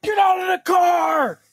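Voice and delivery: furious road-rage yell; road rage shout. Retold or imitated. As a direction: bellow it at the driver like a raging man. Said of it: "A dude was road raging".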